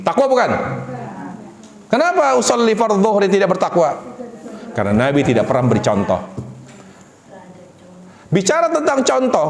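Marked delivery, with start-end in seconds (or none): none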